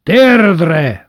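loud, high, held shout; booming tyrant's bark; thundered command. A narrator's reading. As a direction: thunder the order out. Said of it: "dörögte Bátyó".

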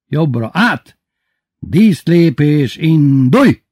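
slow, loud, high parade orders; drill command. A narrator's reading. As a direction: draw out the order, punch the last word. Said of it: "vezényelt eltorzult arccal". (hangos katonai vezényszó)

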